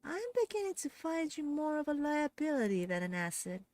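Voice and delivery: high, exasperated villain voice; irritated groan. A narrator's reading. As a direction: dismiss her coldly. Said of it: "The voice groans".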